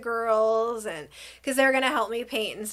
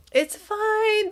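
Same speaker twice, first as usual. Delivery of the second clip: high-pitched pretend it's-okay voice; put-on polite forgiveness, pitched up; feigned reassurance; 10 semitones higher, 3 dB louder than usual. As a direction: brush it off sweetly while meaning the opposite. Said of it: "Though I really would, I was like, Oh, heck no".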